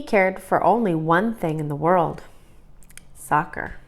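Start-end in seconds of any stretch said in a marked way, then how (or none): none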